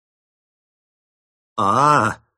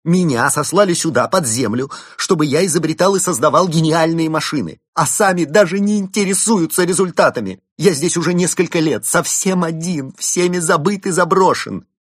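bitter loud rant; 11 semitones higher, 9 dB louder than usual. Acted.

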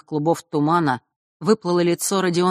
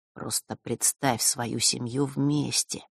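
softer and slow, lulling; 4 semitones lower, 7 dB quieter, slower than usual. A narrator's reading. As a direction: murmur it like a soothing spell. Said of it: "продолжал вещать Острогор".

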